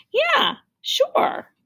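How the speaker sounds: put-on high, hesitant boy; child's voice. A narrator's reading. Tim answering the can man, high child voice. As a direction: brush it off like a shy kid.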